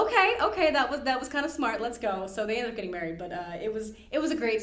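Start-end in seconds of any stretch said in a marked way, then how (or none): 0.1-2.3 amused king, lightly voiced. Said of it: "Fingal thought that was".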